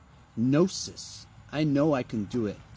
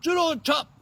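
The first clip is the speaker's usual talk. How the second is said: much higher, hammed-up movie shout; Austin Powers impression; 12 semitones higher, 3 dB louder than usual. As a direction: bark it like a spy-spoof hero. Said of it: "Like Austin Powers said".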